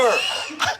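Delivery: high, shouted burst of laughter; shrieking laugh. Real, not acted. Reacting to the six-leaf clover reveal, shrieking laugh.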